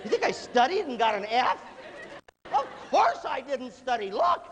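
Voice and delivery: high, exasperated mock comeback; hammed-up sarcastic student outrage; incredulous student retort. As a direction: answer the teacher's obvious question with scorn. Student answers teacher's stupid question.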